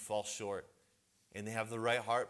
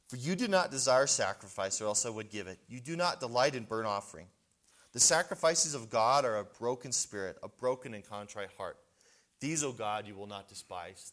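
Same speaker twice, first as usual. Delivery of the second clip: louder, measured reading of scripture; 6 dB louder than usual. Acted. Preacher reading Paul's words aloud to the men, proclaiming. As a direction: proclaim the verses to the congregation. Reads Psalm fifty-one.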